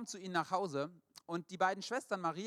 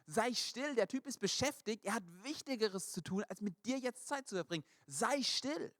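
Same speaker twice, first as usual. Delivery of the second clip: a touch of irritated scolding, pitched higher; annoyed shushing; 7 semitones higher than usual. Retold or imitated. Crowd telling the beggar to shut up, annoyed. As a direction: brush the beggar off impatiently. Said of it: "die sagen zu diesem Bettler".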